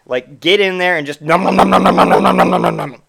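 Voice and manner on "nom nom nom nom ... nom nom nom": loud obnoxious chomping nom noms. Shown as a demonstration. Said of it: "like that, as loud and obnoxious as you can".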